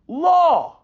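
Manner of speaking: high, loud punch on Law; loud emphatic repeat. Real, not acted. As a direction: hammer the single word Law.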